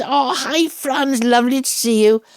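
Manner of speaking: high, bright mock gush of a warm welcome; gushing greeting. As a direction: pitch it high like spotting an old friend across the room. Graham Henry greets her warmly.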